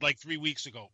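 ordinary talk, nothing marked

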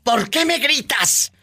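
high, slow mock complaint; lightly hammed-up protest; acted complaint. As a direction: whine it slowly as the put-upon husband. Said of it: "cuando su viejo les diga".

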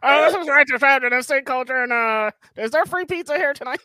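high squeaky Alvin-like voice; chipmunk voice. Retold or imitated. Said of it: "you just gave me fucking [name]".

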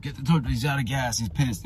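repo men calling out. Retold or imitated. Repo men talking about the stranded driver, imitated urgent voice.

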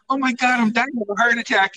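high, fast mock panic over a heart attack; mock dramatic mom. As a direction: ham up a mom's fake dying.

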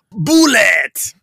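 put-on loud Persian-accented shout. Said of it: "With a deep Persian accent".

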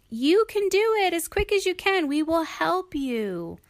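bright, high cheer of encouragement; rally her like eager friends. Acted. Friends cheering Sheila on, high and eager.

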